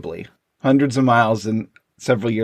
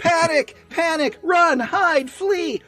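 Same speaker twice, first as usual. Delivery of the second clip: piercingly high comic panic yell; hammed-up falsetto alarm, like a frantic cartoon kid; Sally; slower than usual. Girl at Snoopy's doghouse spreading the news, mock-hysterical yelling. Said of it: "she freaks out and starts yelling".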